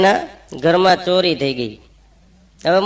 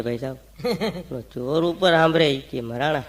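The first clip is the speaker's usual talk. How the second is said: low, much softer hushed words; 3 semitones lower, 7 dB quieter than usual. Retold or imitated. Householder hushes neighbours about the thief upstairs, much softer. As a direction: keep it down so the thief upstairs can't hear. Seth talks while thief listens.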